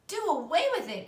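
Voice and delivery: high, loud shocked cry; a girl crying out in disbelief; cried out. A narrator's reading. Fern to her mother, crying out in shock. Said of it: "cried Fern".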